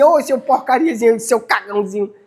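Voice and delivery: high, affectionate pet-name voice; sweet talk to dog. Shown as a demonstration. Talking to the dog Lupe, sweetly cooing insults. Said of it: "eu falo com o Lupe assim".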